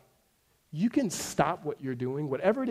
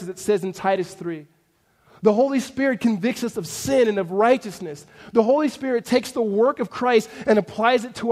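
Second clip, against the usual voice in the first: higher, louder sermon delivery; impassioned preaching; 6 semitones higher, 9 dB louder than usual. Acted. Preacher telling congregation what the Holy Spirit does, impassioned. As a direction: preach it out in driving, emphatic phrases.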